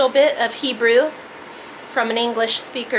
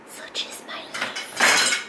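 slow whispered aside; hushed, drawn-out whisper; 8 dB quieter, slower than usual. Real, not acted. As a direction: whisper it slowly, almost to yourself. Whispered repeat of same words.

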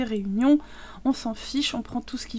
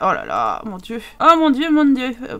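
usual speaking voice

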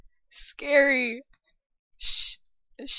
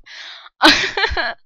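whispered laugh; 3 semitones higher, 10 dB louder than usual. Real, not acted.

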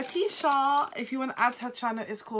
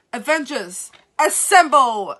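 quoting Captain America as a slow, louder rallying call; Captain America rallying cry; 9 dB louder than usual. Retold or imitated. Fan quoting the movie's Captain America, slow and louder. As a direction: call the team to battle like a hero. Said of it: "when Captain America says".

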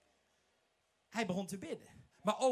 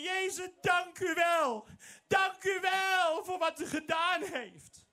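grateful healed leper; 9 semitones higher, 3 dB louder than usual. Acted. Healed leper searches for Jesus, loud grateful. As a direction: push through the crowd, then thank him with all your heart.

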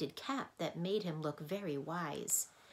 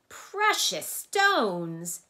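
huge slow roar, much louder; 3 semitones higher, 12 dB louder, much slower than usual. A narrator's reading. Mathemagician roars at Milo's question, very loud. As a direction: roar the words out. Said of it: "he roared even louder".

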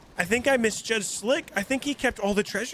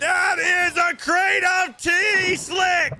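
mock furious yell; 5 semitones higher, much slower than usual. Captain discovers betrayal.

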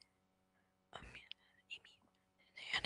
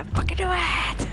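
triumphant; 8 semitones higher than usual. Real, not acted. Gamer gloating to friend, triumphant. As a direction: crow it out.

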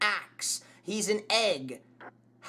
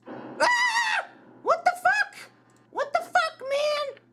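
startled scream; slower than usual. Real, not acted. Jump scare scream.

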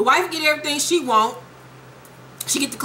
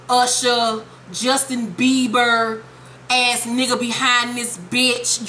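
ordinary delivery

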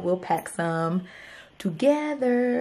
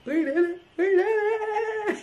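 elated; 11 semitones higher than usual. Real, not acted. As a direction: savor the victory. Celebrating successful launch.

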